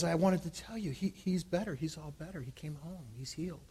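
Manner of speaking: very soft, fading almost to nothing; hushed retelling. Retold or imitated. Mother tells the pastor her baby is healed, hushed. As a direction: retell her news in a hush, trailing away.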